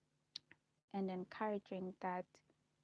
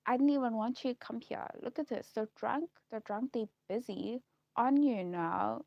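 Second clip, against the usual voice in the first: loud, higher imitation of a worried dad; protesting father; 4 semitones higher, 8 dB louder than usual. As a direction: act out a father urgently warning his daughter. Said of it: "my dad is like".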